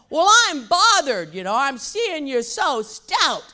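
imitating dad. Voicing her dad in story.

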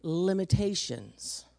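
very slow, each word spaced; preacher stressing his key point; slow emphasis. Real, not acted. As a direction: spell the point out slowly. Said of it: "Very important for you to know that".

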